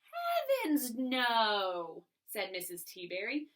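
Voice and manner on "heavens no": reassuring friend. Shown as a demonstration. Missus T Berry comforting Mister Putter, big and reassuring. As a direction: sweep his worry away. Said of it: "So she might say it like this".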